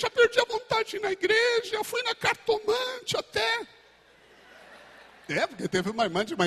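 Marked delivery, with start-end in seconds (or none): 0.0-3.7 whiny believer. Pretend. Complaining believer whines to God in a high voice. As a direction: wail like a spoiled child. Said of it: "Fala, filho".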